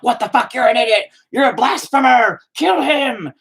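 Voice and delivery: mock the angry fans' outrage; mocking angry fans. Mocking fans who call him a blasphemer, shrill outrage. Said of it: "And they're like".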